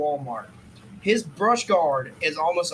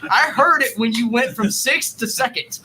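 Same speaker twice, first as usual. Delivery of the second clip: mock cop; 6 semitones higher, 7 dB louder than usual. Cop mocking the loud bike.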